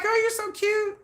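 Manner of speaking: very high, squealed fond imitation; light up while mimicking an adoring husband; playful gushing. Gushes, voicing her husband's fond teasing.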